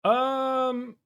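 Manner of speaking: audio cutting out; bad connection. Real, not acted. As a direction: voice dropping out over call. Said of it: "Your voice cut out".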